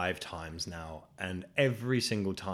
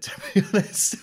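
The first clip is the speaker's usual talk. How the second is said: laughed out much higher and louder; laughing; 12 semitones higher, 8 dB louder than usual. Real, not acted. Host admitting to listeners he's bored, laughing. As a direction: let a laugh burst through the words.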